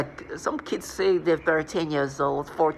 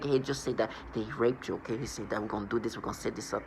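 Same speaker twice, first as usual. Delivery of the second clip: hushed coaching; 5 semitones lower, 9 dB quieter than usual. Retold or imitated. Parent coaching child to lie.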